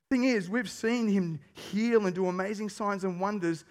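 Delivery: ordinary delivery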